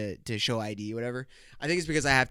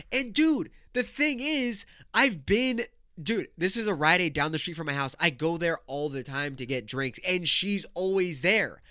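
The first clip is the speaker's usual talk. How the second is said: high-pitched incredulous venting; comically outraged, pitch jumping up; indignant rant; 5 semitones higher than usual. Real, not acted. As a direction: complain to a buddy in disbelief. Indignant rant about the clerk.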